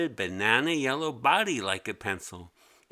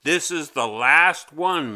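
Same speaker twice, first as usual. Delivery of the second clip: louder, firm last warning; stern teacher warning; 4 semitones higher, 8 dB louder than usual. A narrator's reading. Teacher handing Mimi her last pencil, loud and stern. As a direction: lay down the law. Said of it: "he said".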